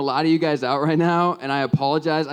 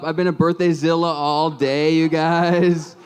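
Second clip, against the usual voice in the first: ordinary delivery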